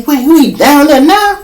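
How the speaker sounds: high, loud, startled question; shocked disbelief. Retold or imitated. Hearing his friend got busted, high startled question. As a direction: jolt up in alarmed surprise.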